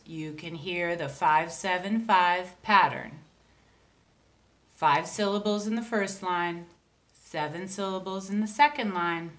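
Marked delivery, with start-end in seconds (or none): none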